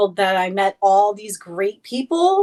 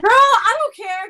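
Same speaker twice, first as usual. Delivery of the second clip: excited; 11 semitones higher than usual. Real, not acted. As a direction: toss it off with a big laugh in the voice. Shrugging off baby gamer teasing.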